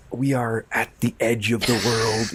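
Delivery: put-on slow, weighty German-accented narration; imitating Herzog's German accent, unhurried; Herzog impression. Narrating a documentary, slowly imitating Herzog's German accent. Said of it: "did the narration in his voice with accent".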